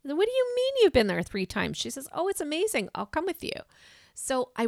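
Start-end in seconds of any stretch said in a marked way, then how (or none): 0.2-1.8 high, loud disbelieving question; surprised. Retold or imitated. [name] reacts to her friend's news, high and loud in disbelief. 2.1-3.5 eager friend. Retold or imitated. Friend reassures, voiced higher.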